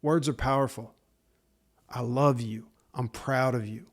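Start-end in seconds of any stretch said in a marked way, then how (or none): none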